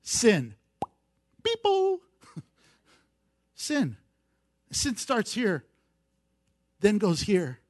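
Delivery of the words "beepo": high mock game-show buzzer; buzzer sound. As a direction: buzz it like a wrong-answer buzzer. Mock buzzer for wrong answer.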